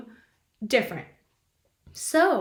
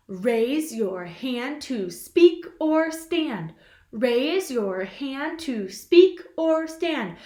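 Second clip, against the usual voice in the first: slow, steady chant of the rule; slow recitation; much slower than usual. Shown as a demonstration. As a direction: recite it slowly for kids to repeat. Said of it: "Rule number two".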